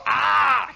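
loud high mock scream of a corn ear. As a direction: shriek like corn ripped off the stalk. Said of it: "those corn ears scream".

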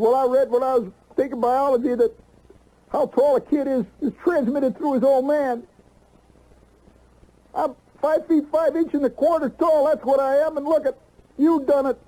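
put-on high, aggrieved teenage whine; high-pitched whining kid accusing his father; whiny accusing kid. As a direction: blame your dad in a high whine. Son accusing father, whiny complaint.

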